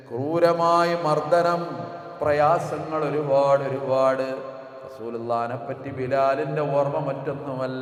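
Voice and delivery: swelling tuneful sermon cadence; impassioned preaching. Acted. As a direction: lift the voice into a mournful sermon chant. Preaching builds to chant.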